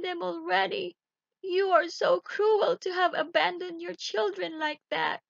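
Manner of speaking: high, fretful wife's lament; worried wife. Acted. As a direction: wring your hands and scold your husband. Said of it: "she said".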